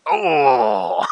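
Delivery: ordinary talk, nothing marked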